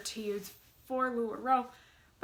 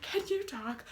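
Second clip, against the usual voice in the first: high-pitched retold excited gasp of news; freaking out; 8 semitones higher than usual. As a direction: re-enact a giddy, freaked-out phone call. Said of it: "on the phone freaking out".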